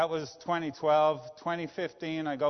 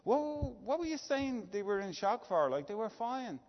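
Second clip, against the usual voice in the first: higher, lightly mocking Colin voice; imitating Colin; 9 semitones higher than usual. Colin in Bill's old office, brushing off [name]'s worry in a higher mock voice. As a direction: mimic the boss, pitched up and dismissive. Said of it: "and Colin says to me".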